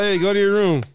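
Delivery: high mock ventriloquist dad. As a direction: imitate a dad giving orders like a dummy. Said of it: "He used to turn into a ventriloquist".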